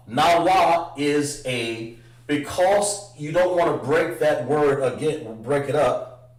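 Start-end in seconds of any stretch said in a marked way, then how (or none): none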